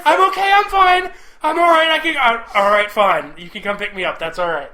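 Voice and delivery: high, fast, breathless I'm okay; panicky wife. Retold or imitated. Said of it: "She puts her phone down and she just deep breathe".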